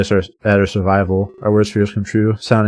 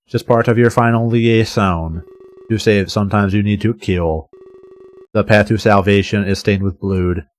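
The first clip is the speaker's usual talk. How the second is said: plain reading voice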